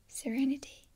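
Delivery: ordinary talk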